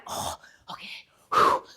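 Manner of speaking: whispered, puffing self-calming; nervous breathing. Retold or imitated. Intern about to face five hundred, acted nervous whispered breaths. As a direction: breathe out hard to steady yourself.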